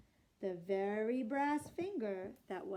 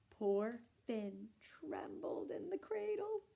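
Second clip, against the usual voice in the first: slow suspense reading; 4 dB quieter, slower than usual. A narrator's reading. Storyteller reading suspense slowly.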